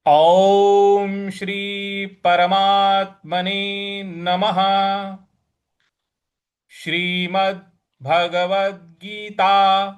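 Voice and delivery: slow, measured Sanskrit recitation; solemn scripture reading. Acted. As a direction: recite the heading slowly and reverently. Formal recitation of chapter opening.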